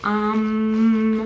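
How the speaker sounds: loud, droning "um"; drawn-out um. Retold or imitated. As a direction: stall with one long loud hum. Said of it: "going like that".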